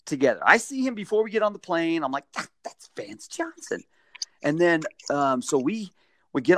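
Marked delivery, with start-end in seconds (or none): 2.3-3.8 high breathless whisper; excited whisper. Retold or imitated. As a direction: starstruck hush to yourself. Starstruck inner thought.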